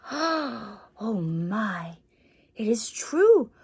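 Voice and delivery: hushed, breathless whisper of wonder; a whispered gasp of awe; awed whisper. Acted. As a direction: whisper it like a child amazed by the story. Awed child character whispers.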